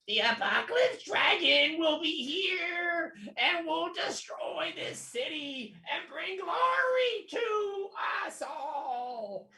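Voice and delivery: high, slow, shrieking kobold preacher; insane sycophant kobold voice. Acted. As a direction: squeal it out like a frenzied kobold cultist. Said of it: "in the deepest kobold voice you've ever heard".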